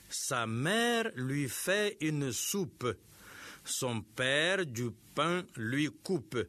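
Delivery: slow, clear model reading; slow demo reading. Shown as a demonstration. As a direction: read each word slowly for the class. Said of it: "on va faire des gestes sur".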